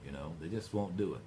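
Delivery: ordinary talk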